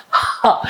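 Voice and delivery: ha breathed out from the lungs; breathy h demo. Shown as a demonstration. Teacher demonstrating the h sound. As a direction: breathe out ha from the stomach. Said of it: "And we say like a ha".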